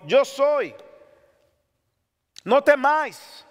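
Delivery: slow, loud Jesus reassuring disciples; Jesus calling out. Acted. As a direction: call out over the storm. Quoting Jesus.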